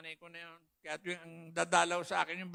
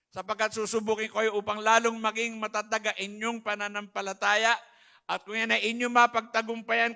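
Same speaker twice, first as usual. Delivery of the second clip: loud, fervent scripture preaching; 7 dB louder than usual. Acted. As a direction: proclaim the verses to the congregation. Testimony quoting scripture with music.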